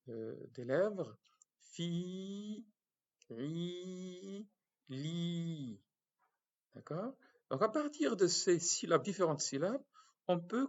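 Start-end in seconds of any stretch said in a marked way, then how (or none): 1.6-5.8 "Fi, ri, li" held long, softer, lips spread; exaggerated lips. Shown as a demonstration. Teacher exaggerating lip movement for the class. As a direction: mouth it clearly for them to watch. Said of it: "Suivez ... le mouvement des lèvres".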